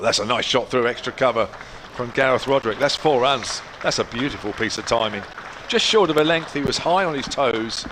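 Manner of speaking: loud, rising thrill at a boundary; excited commentary. Real, not acted. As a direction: call the four with real excitement. Calling a boundary four live.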